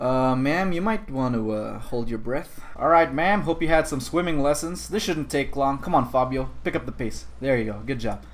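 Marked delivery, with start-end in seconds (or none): none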